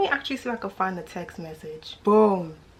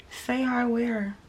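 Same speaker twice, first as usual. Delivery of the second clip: incredulous; 3 semitones higher, slower than usual. Real, not acted. Mocking his late invite.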